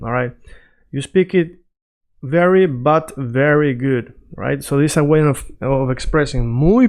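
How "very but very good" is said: slow, stretched "very, but very good"; "very, but very good" drawn out word by word; emphatic. Shown as a demonstration. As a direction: lean on each "very" in "very, but very good". Translates the emphatic compliment.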